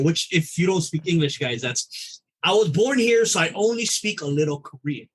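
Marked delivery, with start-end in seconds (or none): none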